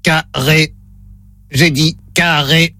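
slow, low robot voice. Pretend. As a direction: read it out like a test robot. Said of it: "Voix de robot enclenchée".

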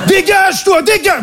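high-pitched, irritated mock Parisian outburst; caricature parisienne agacée. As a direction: jump way up in pitch as an exasperated cartoon Parisian. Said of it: "C'est comme ça qu'il sonne le parisien de base pour moi". (Parisien caricatural qui s'énerve dans l'aigu)

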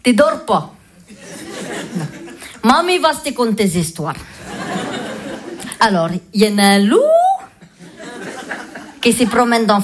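put-on slow, foreign-accented granny reading a bedtime story; grandmother's broken French. Yugoslav grandmother reading a bedtime story to the kids, foreign accent. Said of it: "elle nous lisait des histoires".

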